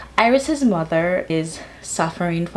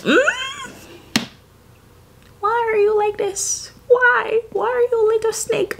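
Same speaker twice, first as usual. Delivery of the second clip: much higher, exaggerated disbelieving voice; mock scolding. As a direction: scold him like a betrayed fan, pitched way up. Mock scolding a book character.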